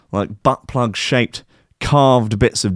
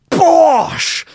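drawn-out, higher exclaimed bosh; sudden bosh; 4 dB louder than usual. A narrator's reading. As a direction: slam it out like a punch landing. Sudden knockout in story.

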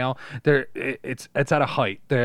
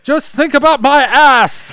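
very high, loud, slow joke voice; silly mock coaxing; 17 dB louder, slower than usual. Joking as a character urging Magneto, high slow silly voice. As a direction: stretch it out absurdly.

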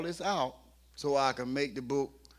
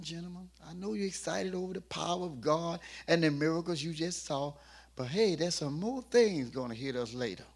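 calming down; 3 semitones higher than usual. Acted. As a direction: settle the excited men gently. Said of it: "He calmed them down".

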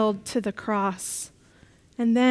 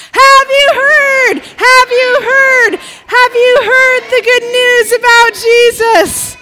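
much louder, much higher shout; as loud as possible; 11 semitones higher, 13 dB louder, slower than usual. Acted. Mary recognising Jesus, shouting as loud as possible. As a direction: yell it to everyone. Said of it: "as loud as possible".

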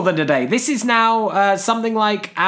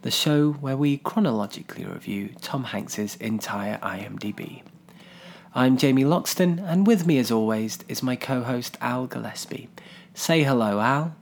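hushed, lowered Desert Island Discs voice; Kirsty Young impression; 8 semitones lower, 7 dB quieter than usual. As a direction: purr the welcome like a calm Radio 4 presenter. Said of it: "How was my Kirsty Young impression?".

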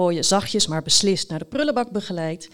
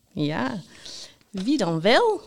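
playful, teasing question with a swooping tune; slower than usual. A narrator's reading. Host Jaap keeps the listeners waiting, teasing. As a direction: tease them with the question, no shout. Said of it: "Zei Jaap plagerig".

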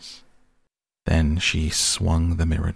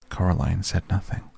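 ordinary delivery

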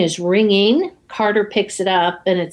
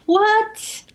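very high, drawn-out mock bafflement; incredulous; 12 semitones higher than usual. Imitating co-host watching the twist, incredulous. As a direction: squeal it in disbelief.

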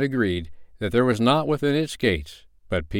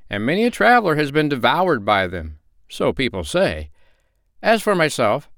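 higher, louder fearful tale; man's voice; 8 semitones higher, 3 dB louder than usual. Acted. Man describes the lions to the travelers, higher. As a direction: tell of the lions with dread. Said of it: "repeated the man solemnly".

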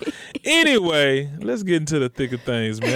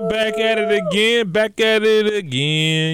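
high, loud announcer-style welcome; hype host intro; 10 semitones higher, 3 dB louder than usual. Acted. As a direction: open the show like a hype man.

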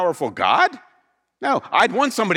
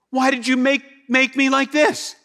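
higher complaining clay voice; 6 semitones higher than usual. Acted.

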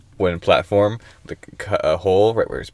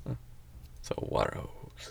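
whispered aside; 20 dB quieter, much slower than usual. Real, not acted. Deadpan aside.

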